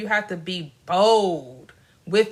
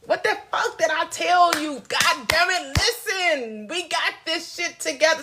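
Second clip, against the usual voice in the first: higher-pitched, exasperated scolding of oneself; stern self-talk; 7 semitones higher than usual. Acted. Speaker demonstrating how to cuss yourself out, stern self-talk. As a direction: act out telling yourself off, sharply pitched up. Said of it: "sometimes you gotta be like".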